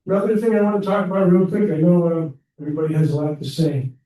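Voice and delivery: shaky, nervous, speak through it; shaking voice. Real, not acted. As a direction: voice trembling with nerves. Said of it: "Sorry, I'm shaking".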